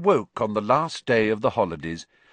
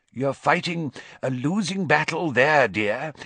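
lightly voiced wheezy mirror, higher; a hint of an old wheeze, higher; 6 semitones higher than usual. A narrator's reading. A talking mirror teasing Harry, lightly wheezy and higher. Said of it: "said his mirror in a wheezy voice".

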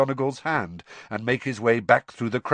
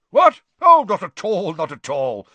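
loud, high jolt, settling down; start, then brush it off heartily; booming surprise easing to reassurance; startled; 8 semitones higher, 10 dB louder than usual. Acted. Bagman, startled, reassures Percy.

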